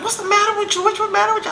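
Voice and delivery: high-pitched mock mom voice; put-on high voice of an alarmed mother; imitated worried mom. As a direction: pipe it up like a mother demanding answers. Mom confronting her, acted out.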